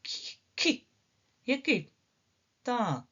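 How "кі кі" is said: кі breathed without voice; teacher isolating the first sound; whispered ki. Shown as a demonstration. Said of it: "кіт".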